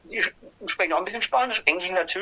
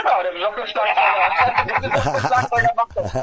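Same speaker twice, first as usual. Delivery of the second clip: real laughter through the words; laughing joke; 3 semitones higher, 4 dB louder than usual. Real, not acted. Band member joking about the drummer, laughing. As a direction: say it while cracking up.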